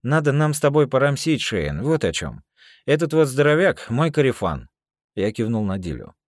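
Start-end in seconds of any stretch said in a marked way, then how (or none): 0.0-4.7 loud, high jailhouse swagger; russian prison slang. Acted. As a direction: lean on him like an old con. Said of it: "меня несло дальше".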